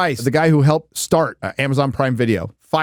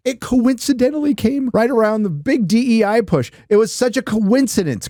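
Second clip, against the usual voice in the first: pitched up, dripping with sarcasm; mock-innocent, much higher, feigning surprise; sarcastic; 9 semitones higher than usual. Real, not acted. Mocking the timing to his co-host, sarcastic and pitched high.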